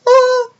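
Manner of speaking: very high imitated dog whine; imitated dog noise. Dog answering a scolding, high imitated whine. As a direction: do the dog's high 'oo' cry. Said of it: "And Beethoven goes".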